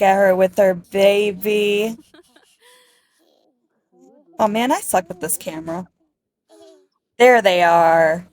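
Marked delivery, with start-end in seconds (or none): none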